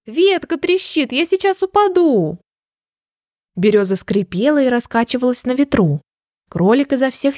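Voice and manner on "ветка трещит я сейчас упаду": high-pitched storybook alarm; distant cry for help. A narrator's reading. Rabbit warning the branch is breaking, high alarmed cry. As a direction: wail lightly from far up a tree. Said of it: "раздался его голос издалека".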